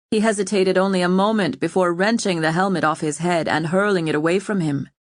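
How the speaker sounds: plain reading voice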